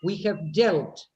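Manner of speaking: slow model of "dealt" with a short e, as in "delt"; corrective pronunciation. Shown as a demonstration. Teacher corrects a student's reading, slowly modelling "dealt" with short e. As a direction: draw out the phrase, landing clearly on "dealt" as "delt".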